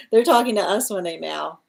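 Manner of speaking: ordinary talk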